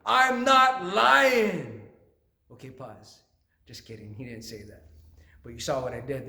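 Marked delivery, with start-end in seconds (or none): 0.0-1.9 loud, high, drawn-out mock outburst; more excited. David hamming it up to King Saul, excited. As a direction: belt it out slowly for the pun, hammed-up. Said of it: "he got more excited. He goes".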